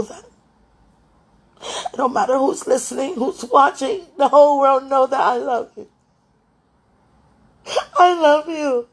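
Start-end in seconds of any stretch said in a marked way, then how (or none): 1.6-8.9 loud, high, tearful cry of love; crying declaration. Real, not acted. Crying out her love for Jesus, loud and high. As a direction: sob it out to the whole world.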